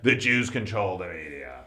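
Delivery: low, soft mock of a bigot's line; a hint of a flat sneering bigot. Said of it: "it's like".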